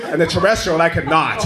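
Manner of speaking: ordinary talk, nothing marked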